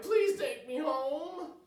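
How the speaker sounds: slow, high begging; pleading. Acted. Child begging her mother to go home. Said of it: "I cried".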